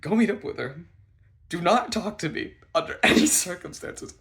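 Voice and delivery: high, loud, escalating orders; frantic urgency. Retold or imitated. Ordering friend away while running to bathroom, frantic. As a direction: build from apology to panicked command.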